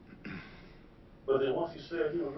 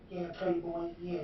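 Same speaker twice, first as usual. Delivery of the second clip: higher put-on voice, Playboy drawn out; imitating Phil's voice; 5 semitones higher, 7 dB quieter, slower than usual. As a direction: mimic his greeting again, lightly. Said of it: "This is his voice he used".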